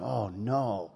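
low, softened mock dismay; quiet dismay. Preacher watching his partner nod, quietly dismayed. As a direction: sink into a hushed "uh-oh" feeling. Retold dismay at being corrected.

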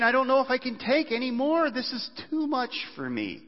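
high, pleading voice of Jesus in the garden; anguished Jesus. Acted. Preacher voicing Jesus in the garden, pleading and high. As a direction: let the words strain upward as if they can't be borne. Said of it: "He's saying".

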